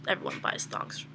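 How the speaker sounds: talking herself down under her breath; whispered reassurance. Retold or imitated. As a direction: hush it to yourself. Self-reassuring inner thought.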